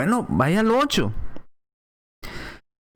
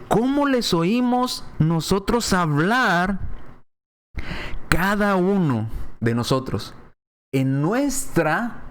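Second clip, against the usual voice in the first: slow reading; 4 semitones higher, much slower than usual. Acted. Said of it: "note cómo lo dicen ellos".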